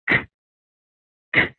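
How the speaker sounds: "k" as a short burst from the back of the tongue; isolated k sound. Shown as a demonstration. Teacher demonstrating the k sound on its own, crisp. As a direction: pop a bare "k" from the back of the palate. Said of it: "to make that".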